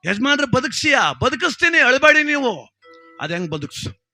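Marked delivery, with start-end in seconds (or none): 0.0-1.1 high, raised disbelief; incredulous question. Acted. Villager asking Dasappa to confirm the miracle, incredulous. 1.1-2.6 very high, loud acted promise; emphatic boast. Dasappa promising to revive the headman, emphatic shout.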